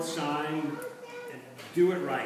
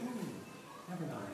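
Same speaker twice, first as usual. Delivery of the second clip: soft, sugary backing-off; mock-sweet retreat, much softer; a hint of a scheming witch turning sweet; sweet con artist witch; 10 dB quieter, slower than usual. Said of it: "her sweet kind of con artist act".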